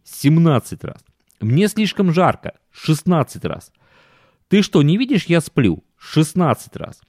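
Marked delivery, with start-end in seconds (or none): 1.4-2.5 lightly voiced complaining wife; a hint of a tired, whining wife; whiny wife voice. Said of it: "аргументы, которые она приводила". 4.5-5.8 lightly voiced complaining wife; a hint of a tired, whining wife; whiny wife voice. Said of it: "аргументы, которые она приводила".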